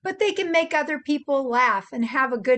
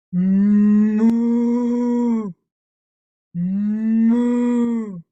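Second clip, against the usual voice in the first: cow moo; 8 dB louder than usual. Shown as a demonstration. Showing the kids a cow's loud moo. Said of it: "let's see if I can moo a little bit more like a cow".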